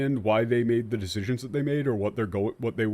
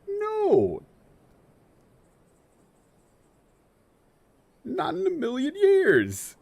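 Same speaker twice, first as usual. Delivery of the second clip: much higher, sing-song dismissal; mock squeaky no; slower than usual. Real, not acted. Mockingly refusing the translators' phrase in a squeaky voice.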